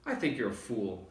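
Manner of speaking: ordinary delivery, nothing marked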